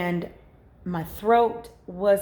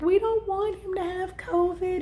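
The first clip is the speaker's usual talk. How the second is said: put-on higher, childlike tearful plea; tearful children; 11 semitones higher than usual. Children tell their mother they don't want their brother to have covid, tearful. Kids crying about sick brother.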